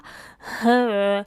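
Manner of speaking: loud breaking-up laugh. Real, not acted. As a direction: burst out laughing mid-reading.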